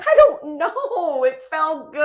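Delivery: high, slow, laughing confession. Real, not acted. To co-host confessing the jinx, high laughing. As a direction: squeal it out through laughter.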